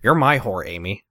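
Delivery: lightly done character voice in American accent; restrained American-accented imitation; Ben. Shown as a demonstration. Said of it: "what Ben voice would sound like".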